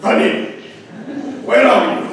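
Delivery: "Honey" shouted loud and high, the word honey as an irritated call; exasperated call. Retold or imitated. Preacher mimics a spouse shouting for a partner, annoyed and loud. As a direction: yell "Honey, where are you?" in mock annoyance. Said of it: "it doesn't mean honey, ... laugh".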